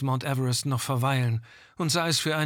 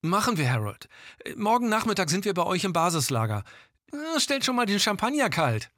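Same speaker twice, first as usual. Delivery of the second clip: over walkie talkie; 7 semitones higher than usual. Acted. Gilda signs off on radio.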